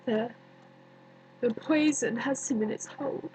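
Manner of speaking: hushed, halting, long pauses; quiet, sorrowful recollection with space between phrases; haunted grief. Acted. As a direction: sink softly into a haunting dream. Recalls tomb, haunted by dreaming of Romeo.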